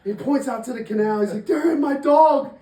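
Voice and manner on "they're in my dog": high, loud imitation of panic; mock frantic cry. Storyteller as dog owner pointing at canal, mock frantic cry. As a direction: cry out for rescue, hammed up.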